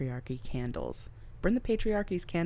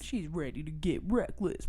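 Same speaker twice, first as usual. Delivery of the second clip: ordinary talk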